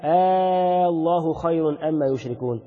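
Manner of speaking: "آه الله خير" opening on a long held aa; slow, drawn-out aa into الله, then recite on; questioning long hamza. Shown as a demonstration. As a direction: stretch the first vowel of الله as a Quranic question particle. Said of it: "ده استفهام".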